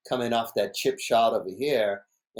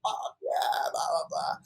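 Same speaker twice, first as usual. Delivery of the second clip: higher, gloating, trailing to a whispered blah; cocky Brock; 10 semitones higher, 3 dB quieter than usual. Retold or imitated. Imitating Brock bragging, high gloat fading to a whisper. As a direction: brag then brush it off breathily. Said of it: "like cocky Brock".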